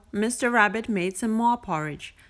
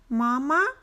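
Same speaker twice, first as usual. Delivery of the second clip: duckling voice; 5 semitones higher than usual. A narrator's reading. Duckling asks.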